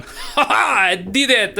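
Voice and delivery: high laughing "ha ha" then a triumphant cry; gloating cheer. Acted. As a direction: crow over the win, laughing high. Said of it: "the human guy down at the bottom going".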